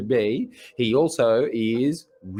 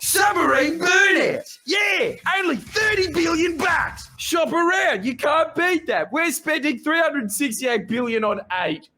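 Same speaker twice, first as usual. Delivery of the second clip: high-pitched drawn-out hype yell; hammed-up sales pitch, very high; mock hype shout; 14 semitones higher than usual. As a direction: shout it like an over-the-top bargain ad. Parody sales pitch for submarines.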